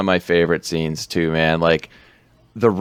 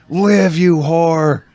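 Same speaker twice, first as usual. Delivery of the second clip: drawn-out, higher-pitched imitation scream; mock scream; 8 semitones higher, 4 dB louder, much slower than usual. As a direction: imitate a screaming nurse, stretching the words out. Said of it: "the nurse starts screaming".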